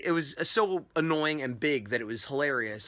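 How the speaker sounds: usual speaking voice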